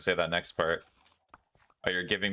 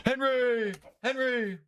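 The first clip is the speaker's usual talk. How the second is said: calling out; much slower than usual. Real, not acted. Calling for a lost chicken.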